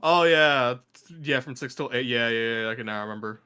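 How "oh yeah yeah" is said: ordinary talk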